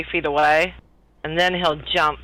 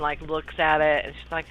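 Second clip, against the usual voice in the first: ordinary delivery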